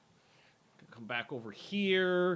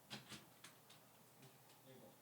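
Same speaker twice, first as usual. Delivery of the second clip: whisper; 3 semitones lower, 25 dB quieter, much slower than usual. Real, not acted. Whispered to the dog.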